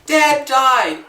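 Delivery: high, loud, blunt cry; mock announcement shout. Relative breaks funeral bill news, mock shouting. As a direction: shout it out as hammed-up bad news. Acts bereaved family member announcing death.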